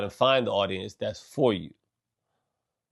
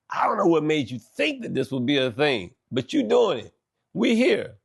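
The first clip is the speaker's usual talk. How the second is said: mock bafflement; 5 semitones higher than usual. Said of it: "sometimes you gotta be like".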